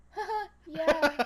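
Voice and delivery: loud, slow fake-laugh agreement; imitated polite bluff. Imitating herself nodding along to Irish coworkers, bluffing. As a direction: laugh along as if you understood. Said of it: "I'm like".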